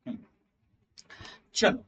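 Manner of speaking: ordinary talk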